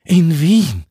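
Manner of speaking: high, loud, aghast outburst; gasped shock. A narrator's reading. Club member hearing the party moves to Vienna, gasped shock. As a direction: gasp it out in loud disbelief. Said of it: "keuchte Annemarie Obermoser".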